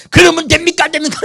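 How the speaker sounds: high, loud scolding question; mock stern Paul. Pastor imitating Paul scolding believers, high mock scolding. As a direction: scold the flock like a strict apostle. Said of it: "이렇게 말하는데 바울은".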